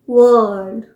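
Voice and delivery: वर्ल्ड drawn out with a slight r; American pronunciation. Shown as a demonstration. Teacher showing the slightly sounded r, American pronunciation. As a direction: say a long "wurrld" for वर्ल्ड, r heard. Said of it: "आर slightly pronounce किया जाता है".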